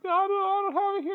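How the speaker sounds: very high, loud mock panic; panicked pawnbroker. Jeeves pleading with the gunman, panicked squeaky voice. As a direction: squeal it like a cornered cartoon coward.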